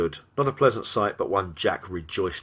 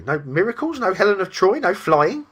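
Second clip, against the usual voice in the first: high, mounting disbelief, getting louder; incredulous; 12 semitones higher, 6 dB louder than usual. A narrator's reading. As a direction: pile up questions in rising disbelief. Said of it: "it asked incredulously".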